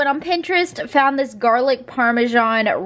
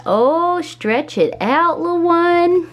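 higher, cooing pet talk; 6 semitones higher, slower than usual. Real, not acted. Owner coos at her little dog while it stretches, in higher baby talk.

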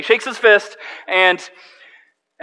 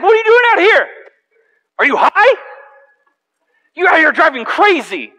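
much higher, louder angry yell; irate driver; 12 semitones higher, 6 dB louder than usual. Retold or imitated. Angry driver yelling at the pastor, loud and high. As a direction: blast him like an irate stranger. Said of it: "And he's like".

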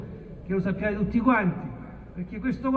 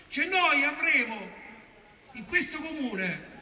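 usual speaking voice

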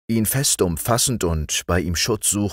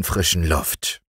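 ordinary delivery, nothing marked

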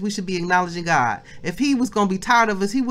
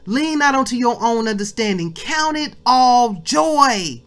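louder, driving sermon voice with punched words; fired-up preaching; 5 semitones higher, 5 dB louder than usual. Acted. Preacher exhorting the family to acknowledge God, louder. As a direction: preach it at full force to the congregation. Preacher building to full force.

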